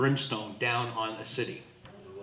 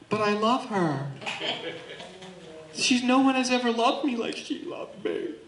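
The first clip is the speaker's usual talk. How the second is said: high, mock-tearful pleading; lovesick pleading; 9 semitones higher than usual. Believer defending the Satanist they love, mock plaintive. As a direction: whimper excuses like someone besotted, hammed up.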